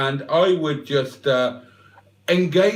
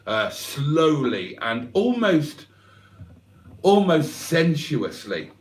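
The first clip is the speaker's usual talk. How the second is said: normal talking voice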